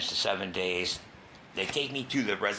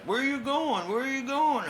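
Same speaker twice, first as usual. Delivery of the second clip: very high, urgent dad voice; imitating the alarmed father; worried dad; 5 dB louder, slower than usual. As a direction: call after him, pitched way up. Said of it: "My pops is like".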